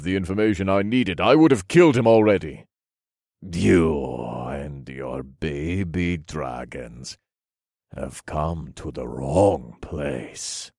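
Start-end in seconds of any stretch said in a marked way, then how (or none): 3.4-10.7 low, slow, sinister voice; slow, low threat; villain's menace. A narrator's reading. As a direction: warn them darkly, drawing out each word.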